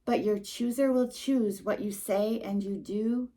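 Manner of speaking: plain reading voice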